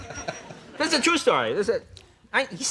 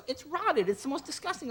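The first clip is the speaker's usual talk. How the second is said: high, softer, appalled clerk; disgusted shop clerk; 7 semitones higher, 9 dB quieter than usual. Retold or imitated. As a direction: recoil in mock disgust. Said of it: "The guy's".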